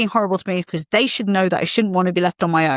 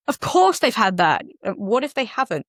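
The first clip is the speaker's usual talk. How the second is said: ordinary delivery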